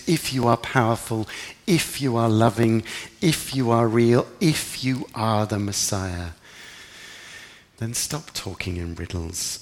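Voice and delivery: mild frustrated prayer, slowing on key words; pleading demand. Retold or imitated. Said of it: "a prayer with the words of the people".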